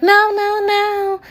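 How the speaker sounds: hammed-up high scream; mock scream. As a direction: imitate friend shrieking no. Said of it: "She's screaming and shit".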